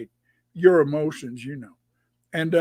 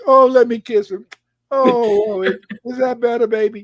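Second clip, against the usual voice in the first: higher-pitched mock mothering coo; imitated coddling mother; 4 semitones higher, 5 dB louder than usual. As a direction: fuss over the hurt child, imitating mama. Mother coddles hurt sister.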